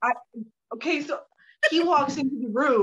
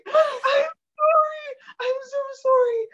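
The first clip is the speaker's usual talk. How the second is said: high-pitched, drawn-out mock wail; hammed-up pleading, stretched and squeaky; panicked apology; 14 semitones higher, 4 dB louder, slower than usual. Retold panicked apology to the musician.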